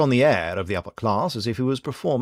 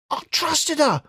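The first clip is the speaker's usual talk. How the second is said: rasping; slower than usual. A narrator's reading. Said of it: "he rasped".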